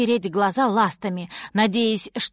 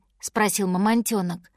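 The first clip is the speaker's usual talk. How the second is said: ordinary delivery, nothing marked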